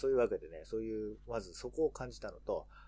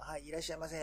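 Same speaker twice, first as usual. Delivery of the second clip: lightly voiced staff welcome, higher pitch; sushi shop greeting; 6 semitones higher than usual. Imitating conveyor-belt sushi staff greeting customers, lightly higher service voice. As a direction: call a polite, high welcome like shop staff. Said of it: "回転寿司屋とか行っても".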